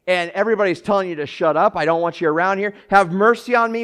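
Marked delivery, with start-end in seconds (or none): 1.8-3.8 loud, high pleading cry; a beggar shouting for mercy over the crowd; desperate shout. Acted. Crying out despite the crowd.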